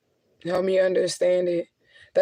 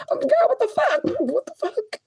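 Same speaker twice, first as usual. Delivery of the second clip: high squeaky what the fuck; mock-exasperated, pitched way up; high-pitched disbelieving what the fuck at God; exasperated plea to God. Shown as a demonstration. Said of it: "why wouldn't I talk to God like this?".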